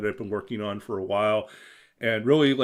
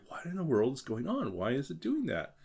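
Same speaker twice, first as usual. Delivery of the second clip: softer, higher, swooping incredulous questions; baffled self-quote; 4 semitones higher, 6 dB quieter than usual. Retold or imitated. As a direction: recount utter bafflement with exaggerated pitch swings. Retells own bafflement at bug.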